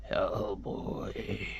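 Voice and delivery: drawn-out voiceless whisper; dry, ghostly whisper. Acted. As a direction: whisper it like a dried-out old king calling. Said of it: "he's gonna sound dry".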